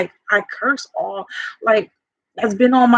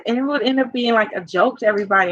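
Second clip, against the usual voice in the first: usual speaking voice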